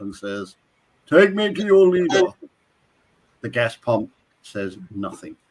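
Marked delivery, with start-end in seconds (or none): 1.1-2.2 higher-pitched commanding alien demand; hammed-up alien captain's order. Alien captain addressing a gas pump, hammed-up demand. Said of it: "captain alien takes out his ray gun".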